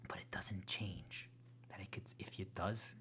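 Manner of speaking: slow hushed whisper of warning. Real, not acted.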